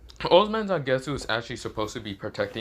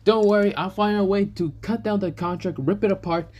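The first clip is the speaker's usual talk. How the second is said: high, forceful character voice starting loud; pitched-up, aggressive retort; acted threat; 9 semitones higher, 4 dB louder than usual. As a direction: burst in with a heated promise. Shiro threatens to break the contract.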